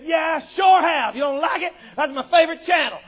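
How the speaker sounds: loud, high, belligerent comeback; loud mock-indignant boasting; defiant retort. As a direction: snap back like a cocky giant. Goliath's defiant retort, acted.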